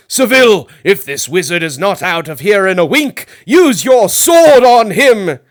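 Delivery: loud, high royal shout, slowing at the end; king shouting. A narrator's reading. King ordering the barber to attack, shouting. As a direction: bark the order across the hall. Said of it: "he shouted to the barber".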